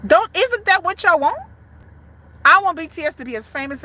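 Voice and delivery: normal talking voice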